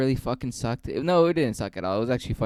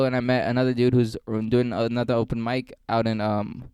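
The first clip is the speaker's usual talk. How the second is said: ordinary talk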